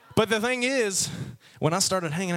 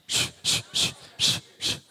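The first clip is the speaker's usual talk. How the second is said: repeated shushing. Real, not acted. Pastor quieting a laughing congregation, repeated shushing. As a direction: hush the noisy room.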